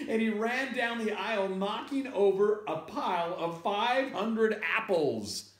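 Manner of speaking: high-pitched, breakneck storytelling; frantic narration. A narrator's reading. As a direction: tell it as a panicked chase. Narrating the panicked flight.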